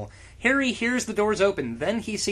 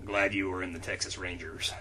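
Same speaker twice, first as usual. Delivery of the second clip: much lower, softer ranger voice; deep Cordell; 12 semitones lower, 8 dB quieter than usual. Acted.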